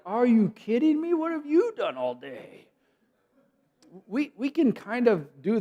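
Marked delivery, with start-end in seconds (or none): 0.0-2.7 much higher, exasperated disbelief; mock inner rant at a coworker; inner irritation. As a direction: let the hidden annoyance out. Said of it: "But inside you're like".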